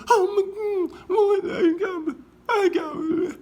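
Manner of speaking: high wailing whimper, slowed; mock horrified wail. Appalled man reacting to his grey face, mock wail. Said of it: "He's like".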